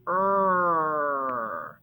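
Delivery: "er" held long and slow, low; drawn-out sound. Shown as a demonstration. Teacher letting pupils hear the combination, drawn-out sound. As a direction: stretch "er" as one sustained r-coloured vowel. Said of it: "and we hear the".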